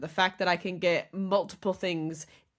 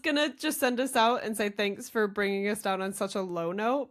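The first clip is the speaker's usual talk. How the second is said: ordinary talk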